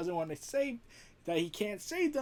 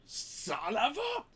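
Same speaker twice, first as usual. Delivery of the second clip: exasperated; 3 semitones higher, 4 dB quieter, slower than usual. Real, not acted. Reacting to the news about Thor.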